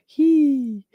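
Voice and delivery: high, drawn-out teary squeal; crying squeal. Real, not acted. Host crying along with chat, high teary squeal. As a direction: let out a thin high "hee" through tears. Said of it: "I'm crying as well".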